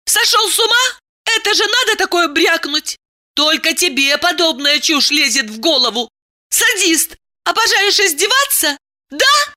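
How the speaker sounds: very high-pitched indignant woman; enraged Diana. Acted. Woman calls lover a sadist, enraged. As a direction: scold him with shrieky high questions.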